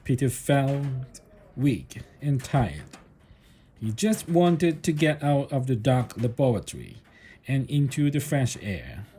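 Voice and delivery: ordinary delivery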